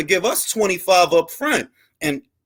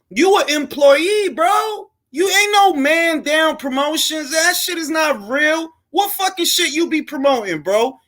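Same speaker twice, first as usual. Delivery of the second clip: heated shouting; 6 semitones higher than usual. Real, not acted. Rant at Stefan Espinoza.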